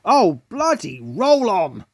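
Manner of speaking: cursing. A narrator's reading. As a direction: grumble the curse out slowly. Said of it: "he cursed".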